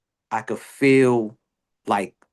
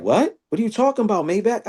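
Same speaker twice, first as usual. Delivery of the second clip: high, puzzled what-are-you-on-about; confused friend; 10 semitones higher, faster than usual. Retold or imitated. Friend baffled when told to leave, high confused pushback. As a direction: play the baffled buddy, pitched way up.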